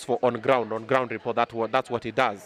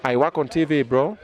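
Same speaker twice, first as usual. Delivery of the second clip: ordinary talk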